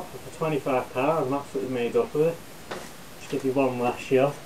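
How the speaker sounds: muffled behind respirator; speaking inside face mask; through a gas mask. Real, not acted. Seen 4: Full-face gas mask with filter worn over the mouth throughout.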